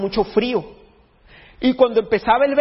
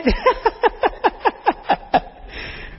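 long high wheezy laugh; real helpless high laughter. Real, not acted. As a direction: crack up at your own slip. Laughs at own slip.

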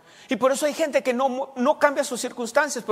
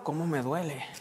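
mock sick complaining; 6 semitones lower, 6 dB quieter than usual. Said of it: "y están hablando".

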